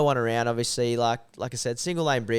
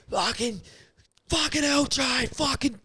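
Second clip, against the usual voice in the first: high, strained mock shout; distant abusive yelling; 13 semitones higher than usual. Mate abusing him from a distance, imitated yelling. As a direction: imitate a mate swearing from far away. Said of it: "just abusing me from a distance".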